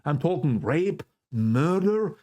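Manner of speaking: ordinary talk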